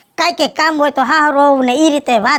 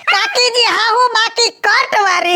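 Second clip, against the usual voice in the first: teasing shout; 4 semitones higher, slower than usual. Acted. Teasing the old aunt as she leaves.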